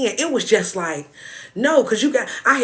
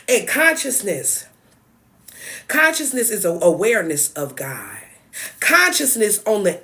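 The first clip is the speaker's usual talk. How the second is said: ordinary delivery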